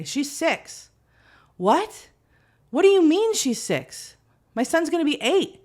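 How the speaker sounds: high-pitched, incredulous exclaiming; can't accept how old the kids are, pitch way up; dismayed disbelief. Acted. Disbelief at children growing up.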